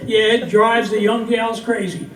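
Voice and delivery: lively, lilting comic verse for a crowd; cowboy poetry recital. Acted. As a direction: tell it as a rhyming yarn from the stage. Recites comic cowboy poem to audience.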